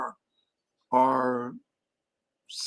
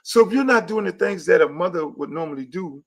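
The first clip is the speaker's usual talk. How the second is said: normal talking voice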